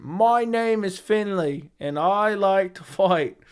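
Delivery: put-on fighter voice, high and very slow; Finley. Streamer answering a request for his best impression, slow high wrestler-style introduction. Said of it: "I guess it's my Finley impression".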